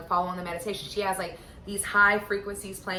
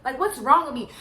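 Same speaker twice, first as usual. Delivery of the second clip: panicked; 8 semitones higher, 5 dB louder than usual. Retold or imitated. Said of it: "So I'm like".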